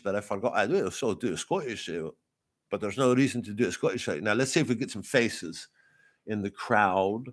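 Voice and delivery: ordinary talk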